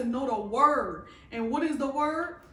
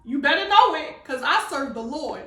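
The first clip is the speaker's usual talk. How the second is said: loud scolding rebuke of the flesh; rebuking her flesh; 2 semitones higher, 8 dB louder than usual. Retold or imitated. Rebuking her own weak flesh, loud and stern. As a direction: preach it at the flesh, loud and fired up. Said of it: "There's times I'll be like".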